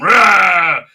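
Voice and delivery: loud imitated roar; a short, loud mock battle cry; mock attack roar.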